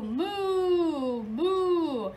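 loud, long mooing cow. Acted. Teacher mooing like the cow for the class, loud. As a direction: moo like a big cow.

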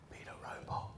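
hushed unvoiced aside; whisper. Trainer's advice to a trainee, whispered conspiratorially. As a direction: breathe it like a secret order.

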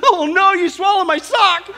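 high, loud, slow comic horror; gasping shock. Acted. Husband to bride about his lost sock, gasping shock. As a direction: draw out the horrified punchline. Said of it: "the husband gasped in shock".

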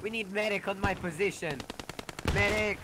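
urgent high call for a medic; man down shout. Real, not acted. Wounded squad lead hit, calling medic.

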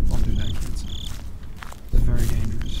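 whispered, then deep low announcer warning; mock PSA warning. A gamer joking to viewers in a mock public service announcer voice. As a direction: warn the kids like a public service ad.

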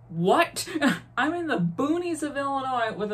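loud shocked what. Retold or imitated. Mom calls hysterical about dad, she answers shocked. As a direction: jolt out a stunned what. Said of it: "And I'm like".

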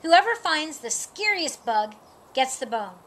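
ordinary delivery, nothing marked

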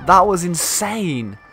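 amazed. Real, not acted. Amazed at exploding portal.

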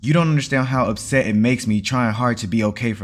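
heated outburst, easing slightly as it goes; angry shout. A narrator's reading. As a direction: shout back at your father, loud from the first word. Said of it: "you shouted at him".

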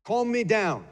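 half-acted parent screaming; mock scream. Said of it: "when we're screaming at a kid".

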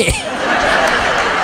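squeaky breathy cackle, very high; mock high laugh. Wife mocking the STD booth owner, high laugh.

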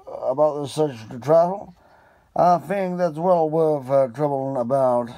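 a keen detective thinking aloud; Holmes voice. Acted.

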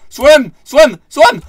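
high, very loud repeated yells; urgent yelling. Real, not acted. Gamer racing to escape drowning, urgent yelling. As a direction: yell each word as a desperate push.

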